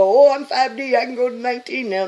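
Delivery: high, loud, fast silly mimic; mock the boastful claim; sarcastic high mimicry; mocking quote. Mocking naive ascension claims.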